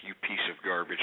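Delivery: very low, soft, seething insult; quiet contempt. Real, not acted. Host to the caller demanding his money, quietly seething. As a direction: drop right down in disgust.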